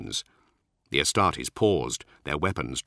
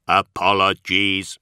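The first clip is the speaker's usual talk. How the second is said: slow, carefully modulated machine voice; a machine trying to sound polite; modulated synthetic voice; 5 dB louder, slower than usual. Acted. The Magos apologising to Nemiel, a slow synthetic voice. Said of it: "his synthetic voice more carefully modulated now".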